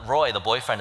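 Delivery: normal talking voice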